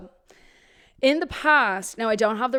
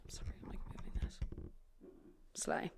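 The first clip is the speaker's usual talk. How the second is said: whispered aside; 21 dB quieter than usual. Real, not acted. Aside while moving microphone.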